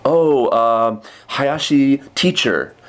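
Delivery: a slow, halting student answer in English; imitating a student. Teacher imitating a junior high student answering, slow and halting. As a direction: play a shy kid hunting for the words. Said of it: "They'll say".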